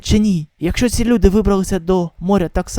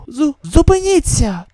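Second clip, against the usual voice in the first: stammered plea; 7 semitones higher, 3 dB louder, slower than usual. Acted. Begging fighters to stop.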